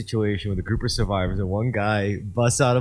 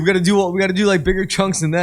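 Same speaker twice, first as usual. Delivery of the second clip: high, louder pushy voice; mock coke bro; 10 semitones higher, 5 dB louder, faster than usual. Party friend pushing bigger chunks of coke. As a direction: bro insisting on bigger lines. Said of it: "He's like".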